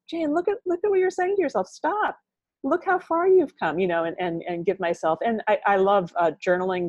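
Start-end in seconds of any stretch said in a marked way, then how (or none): 0.1-3.8 much higher, firm self-talk; inner coach voice. Shown as a demonstration. Talking herself out of negative self-talk, high firm voice. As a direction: stop yourself like a stern coach. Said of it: "That recovery time is".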